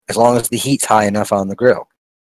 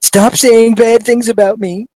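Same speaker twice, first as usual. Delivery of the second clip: high, slow, whining plea; whiny mocking voice; 13 semitones higher, 5 dB louder, slower than usual. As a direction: whine it like a wounded bully. Voicing [name]'s complaint.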